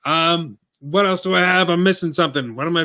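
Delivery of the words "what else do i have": normal talking voice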